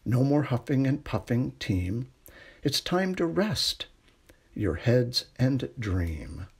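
usual speaking voice

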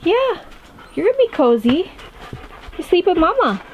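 high, sing-song voice to the dog; doggy talk. Real, not acted. Talking to his dog.

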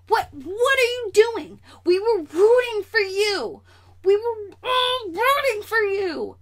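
high, loud, drawn-out mock yell; outraged scolding. Vlogger telling off Margot Robbie over the missing accent, outraged scolding. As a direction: stretch each word in high, over-the-top outrage.

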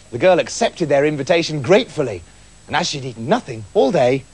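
ordinary delivery

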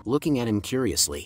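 ordinary delivery

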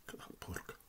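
whisper. Real, not acted. Admiring food, sudden whisper.

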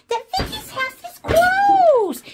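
high, drawn-out proclamation; loud mock announcement. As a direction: call it out loudly, stretching the words. Announces closure in pretend play.

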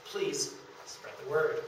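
normal talking voice

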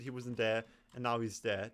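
normal talking voice

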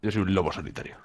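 low lone-wolf voice; self-important deep voice. Pretend. Teammate explaining why he left the group, deep self-important voice. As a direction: put on a deep, mysterious-hero voice. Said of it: "pongo esta voz porque así me creo más interesante".

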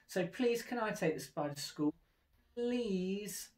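high begging please; pleading. A narrator's reading. Splat begging his mum for the spider. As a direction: whine it eagerly, pleading. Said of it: "he added".

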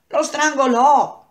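high, loud, drawn-out exclamation; dramatic outburst. Acted. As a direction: burst out with the shocking punchline. Sudden violent punchline of story.

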